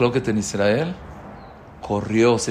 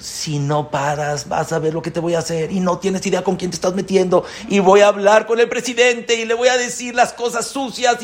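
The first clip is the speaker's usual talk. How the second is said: threatening rant; 7 semitones higher, 4 dB louder than usual. Retold or imitated. Angry neighbor threatening the governor, building to a fast tirade.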